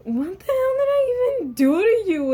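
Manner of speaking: high, loud incredulous outburst; outraged disbelief. Retold or imitated. Student silently protesting the teacher's dirty looks, outraged. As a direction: shoot up high in mock outrage.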